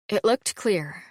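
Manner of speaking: plain reading voice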